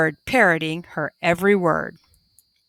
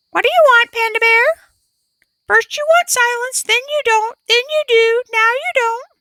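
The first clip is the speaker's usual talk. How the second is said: parrot voice; 4 dB louder than usual. A narrator's reading. Parrot speaks.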